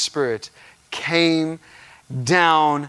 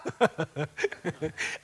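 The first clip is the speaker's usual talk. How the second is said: helpless laughter; 10 dB quieter than usual. Retold or imitated. Laughing fit on the floor.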